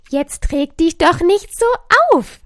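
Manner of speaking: loud, high cheerful call. A narrator's reading. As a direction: call out as Joy, telling Anger off lightly. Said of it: "rief die gelbe Freude".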